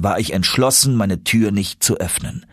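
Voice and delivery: usual speaking voice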